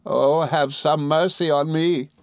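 high, drawn-out plea; Emily, begging. A narrator's reading. Emily crying to Rosa, begging. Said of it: "cried Emily".

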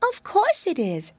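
put-on high, fretful little girl voice; a tiny frightened mouse wailing; timid mousy girl. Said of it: "said the little creature".

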